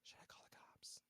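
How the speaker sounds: whispered thought; pharmacist's silent worried whisper; inner whisper. Retold or imitated. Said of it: "she's like ... in her head".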